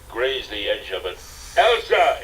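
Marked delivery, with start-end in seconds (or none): 1.6-2.2 high, loud desperate yell; frantic yell. Acted. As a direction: shout her name as she slips. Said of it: "Indy yelled".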